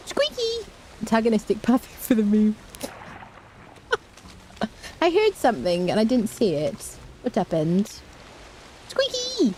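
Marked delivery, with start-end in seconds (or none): 0.1-0.7 much higher squeaky call; high squeal of a name. Real, not acted. Player calls out to her pet Squeaky, high squeal. Calling pet's name fondly. 8.9-9.5 much higher squeaky call; high squeal of a name. Real, not acted. Player calls out to her pet Squeaky, high squeal. Calling pet's name again.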